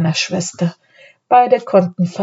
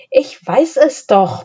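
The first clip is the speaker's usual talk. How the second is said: higher, mildly stubborn insistence; insistent; 6 semitones higher than usual. A narrator's reading. Anne contradicting her doubting brother, insistent. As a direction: hold your ground, pitch lifted. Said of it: "beharrte Anne".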